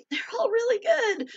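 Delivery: high, squeaky voice coming out of a laugh; giggly praise. Real, not acted. Host praises fellow authors' books, giggly and high. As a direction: say it high, still full of giggles.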